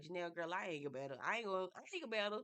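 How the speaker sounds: normal talking voice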